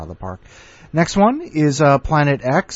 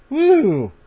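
excited whoop; 3 dB louder than usual. Real, not acted. Host cheering mid-intro.

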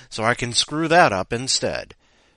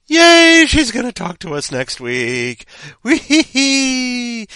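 high, loud squeal of delight; excited cheering; 13 semitones higher, 11 dB louder, slower than usual. Real, not acted. Cheering after story.

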